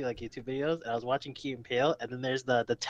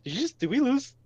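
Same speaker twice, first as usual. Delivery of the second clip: high-pitched mock questioning; lightly hammed-up query, pitched up; voiced sketch character; 7 semitones higher, 4 dB louder than usual. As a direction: act out a puzzled caller ringing back. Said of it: "and he's like".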